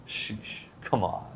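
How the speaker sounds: usual speaking voice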